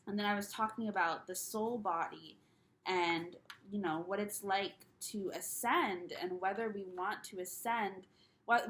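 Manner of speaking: ordinary talk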